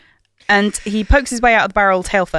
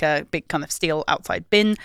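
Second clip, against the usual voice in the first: ordinary talk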